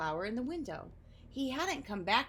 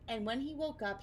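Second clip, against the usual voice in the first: ordinary delivery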